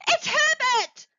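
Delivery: very high, loud frantic scream. A narrator's reading. Mother realising her son is knocking, screaming. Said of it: "she screamed".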